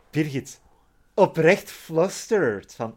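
normal talking voice

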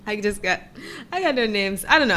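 ordinary talk

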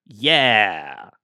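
low noncommittal yeah, drawn out; neutral solid yeah. Shown as a demonstration. Host demos a neutral reply to the listener. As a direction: give a flat, ambiguous yeah. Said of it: "this is my attempt at being neutral".

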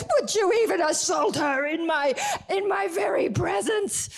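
angry king. Retold or imitated. King catches Haman on the queen's couch, shouting. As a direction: roar at the intruder.

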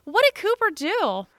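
high, loud protest on the dog's behalf; retold indignant disbelief. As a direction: act out being baffled by the accusation. Retold defence of the blamed dog.

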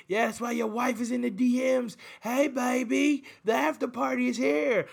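crooning 'hey baby' as a cocky fighter mocking his rival; a hammed-up Conor strutting out of the ring; mock Conor taunt. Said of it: "and Conor's like".

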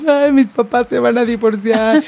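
slow, whiny mock-tearful child voice; imitated child crying. Scared girl telling friend her parents will divorce, mock tearful. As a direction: play the upset little girl, drawn out. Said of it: "le hablé llorando así".

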